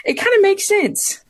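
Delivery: high, giggling through the words; laughing repeat. Real, not acted. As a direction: repeat it while still laughing.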